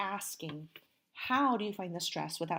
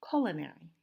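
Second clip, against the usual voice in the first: culinary with CUL longer and louder; first-syllable stress; 2 semitones lower, faster than usual. Shown as a demonstration. Teacher shows the stressed syllable, culinary stressed on CUL. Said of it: "a little bit longer and a little bit louder".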